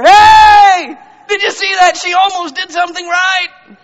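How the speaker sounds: high, full-out celebratory shout; God cheering excitedly. Retold or imitated. God cheering on his child, thrilled shouting. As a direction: leap up and holler with pride. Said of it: "God's gonna come out of his chair".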